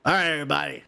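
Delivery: normal talking voice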